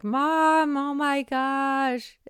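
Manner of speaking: put-on high, drawn-out teen exasperation at mom; exasperated teen. Teens rolling their eyes at mom, high exasperated whine. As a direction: groan it out like an embarrassed teenager. Kids exasperated at mom's mistake.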